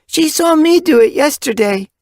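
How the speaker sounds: put-on young kit voice.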